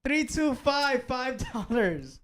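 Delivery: high, drawn-out name and amount; announcer call. Pretend. Co-host announcing a donor's five dollars, slow announcer call. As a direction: call it out slowly like a ring announcer. Calling out a super chat donor.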